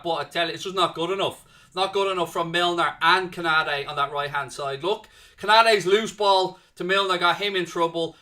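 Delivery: loud, worked-up football commentary; live play-by-play. Real, not acted. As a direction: call the danger loudly, then scold your own defenders. Heated live match commentary.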